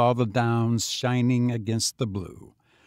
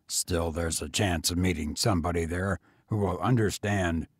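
low, weary tramp; lower, tired vagrant voice; 6 semitones lower, 3 dB quieter than usual. A narrator's reading. Tramp speaks.

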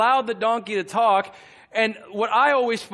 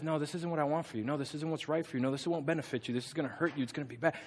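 quiet, steady string of cautions; soft-voiced warning; 3 semitones lower, 9 dB quieter, faster than usual. God warning someone off a wish, soft and quiet. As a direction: caution gently in a hushed voice.

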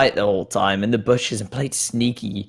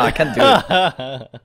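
laughing; 3 semitones higher, much slower than usual. Real, not acted. Laughing at failed voice.

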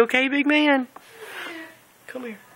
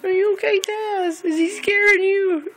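high cooing voice for the cat; pet talk; 10 semitones higher than usual. Real, not acted. Owner reassures the frightened new cat in high baby talk. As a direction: soothe the scared kitten.

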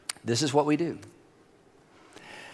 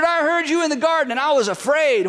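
Adam answering; 8 semitones higher than usual. Acted. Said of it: "He answered".

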